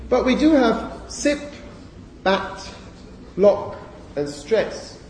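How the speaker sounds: sip, bat, lock said with short closed vowels; demo words. Shown as a demonstration. Teacher contrasting short-vowel words for students. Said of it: "deduct what the rule is".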